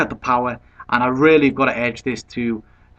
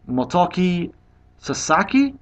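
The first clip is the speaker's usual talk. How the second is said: normal talking voice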